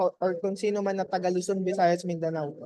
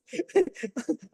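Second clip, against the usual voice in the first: amused laugh; 7 semitones higher than usual. Real, not acted. Laughs at nickname leche man.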